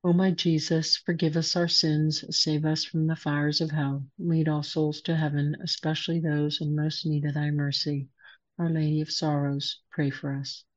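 steady reverent prayer voice; prayer recitation. Acted. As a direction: recite it like a rosary leader. Leads the Fatima prayer.

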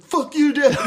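put-on high, loud teen yell; mock yell at dad. Teen son yelling at his father, mock angry. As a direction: yell it up at dad, half laughing.

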